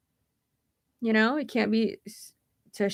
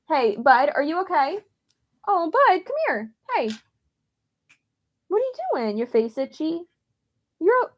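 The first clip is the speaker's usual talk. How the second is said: high-pitched cooing to a pet; pet talk; 7 semitones higher than usual. Real, not acted. Checking on the pet bud to see if okay, high sweet pet talk. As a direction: coo up high, as if to a beloved pet.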